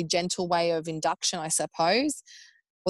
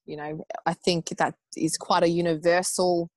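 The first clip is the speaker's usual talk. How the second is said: normal talking voice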